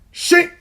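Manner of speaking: high, sharp blade sound effect; sword swish. Retold or imitated. Fan retelling Thor's axe strike, a high sharp shink. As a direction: whip a quick shink like a blade slicing. Sword cuts off Thanos's head.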